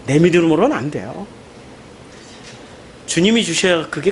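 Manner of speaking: low, soft, humble confessing; quiet confession. Real, not acted. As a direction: confess it quietly, almost to yourself. Preacher drops into quiet confession. (낮고 조용한 고백)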